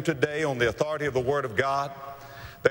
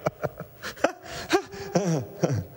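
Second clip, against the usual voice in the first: long laugh tipping toward a sob; laughing that breaks into crying; acted father's laugh; 3 semitones higher than usual. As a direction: laugh until it nearly cracks. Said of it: "he begins to laugh".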